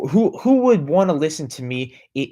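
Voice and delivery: ordinary talk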